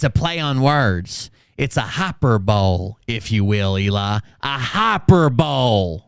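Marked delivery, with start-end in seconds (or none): 1.8-2.9 "hyperbole" said as "HOPPER-bowl"; mispronounced hyperbole. Pretend. Host mocking Eli with wordplay, mispronounced "hyperbole" as "hopper-bowl". 4.4-6.0 "hyperbole" drawn out as "HOPPER-bowl"; mispronounced hyperbole. Pretend. Host repeating the wordplay to Eli, slow mispronounced "hyperbole" as "HOPPER-bowl".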